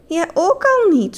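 mildly exasperated, stretched words; sighing. A narrator's reading. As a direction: draw it out like a resigned sigh. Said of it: "zuchtte mevrouw de dief".

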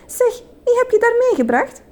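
put-on high-pitched, curious child's question; cheerful storybook little sister; happy. A narrator's reading. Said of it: "zei Sita blij".

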